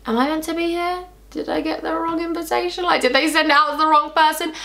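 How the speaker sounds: high disbelieving inner voice; imitating nervous self. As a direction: voice a bewildered guest doubting her invite. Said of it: "I was sitting there like".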